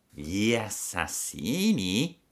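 slow, feigned fox surprise; mock shock. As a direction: pretend to be shocked. (la volpe finge stupore, voce lenta)

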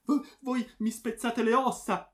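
high, loud pained protest; groaning in pain. A narrator's reading. Friar with his bones being crushed, groaning. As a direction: stammer and groan under a crushing grip. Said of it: "gemé frate Arcangelo".

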